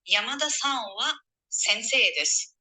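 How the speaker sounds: wa said as wa, not ha; wa in Yamada-san wa sensei desu; particle wa. Shown as a demonstration. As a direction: read the particle wa as wa. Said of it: "as a particle, it would be read as wa".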